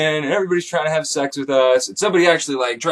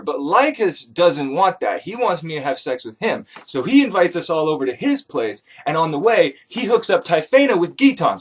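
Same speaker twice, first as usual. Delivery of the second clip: usual speaking voice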